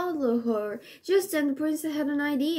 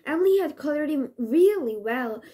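ordinary delivery, nothing marked

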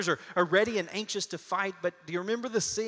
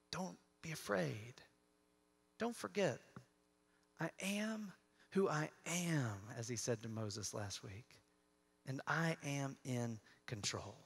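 a hushed, tender voice of God; soft reassurance; 4 semitones lower, 11 dB quieter than usual. Acted. God reassuring his people, soft and tender. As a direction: soften down almost to a murmur. God's voice quoted softly.

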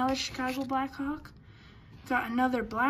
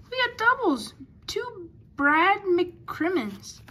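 high, slightly louder realisation; surprised; 6 semitones higher, 4 dB louder, slower than usual. Real, not acted. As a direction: pitch jumps way up in surprise. Finds duplicate cards mid-pile.

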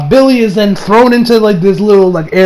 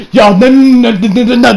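loud denial; 4 semitones higher, 4 dB louder than usual. Retold or imitated. Refusing fake Superman.

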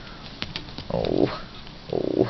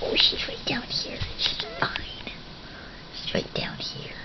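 reassuring whisper, softer; 11 dB quieter than usual. Real, not acted. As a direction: whisper gently she's fine. Hushed reassurance to pet.

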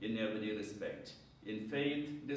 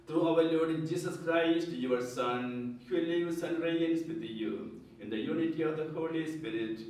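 raised, solemn prayer voice; liturgical prayer; 4 dB louder than usual. Real, not acted. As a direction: pray it aloud to the congregation. Priest's opening collect prayer.

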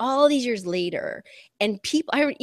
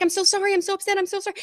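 upset driver; 10 semitones higher, much faster than usual. Retold or imitated.

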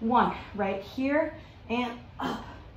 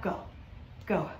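normal talking voice

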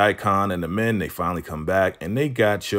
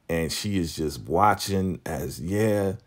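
ordinary talk, nothing marked

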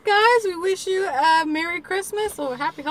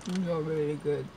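low, soft words with mouth full; chewing while speaking, mouth full; 11 semitones lower, 7 dB quieter than usual. Real, not acted. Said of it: "talk with my mouth full".

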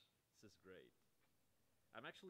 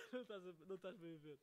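laughing through the words, higher and louder; 5 semitones higher, 8 dB louder than usual. Real, not acted. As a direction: giggle out a protest. Laughing while opening the gift.

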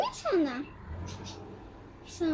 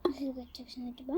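unvoiced whisper; whispering while hiding; 4 semitones lower, 13 dB quieter, faster than usual. Real, not acted. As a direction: whisper it so she won't hear. Hiding from Granny.

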